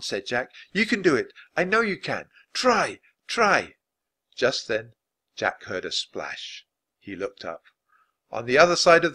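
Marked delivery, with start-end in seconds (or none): 0.7-3.8 high, insistent coaxing; pleading pep talk to someone in trouble; urgent encouragement. A narrator's reading. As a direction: urge them on with raised pitch. Jack urging sinking Annie on.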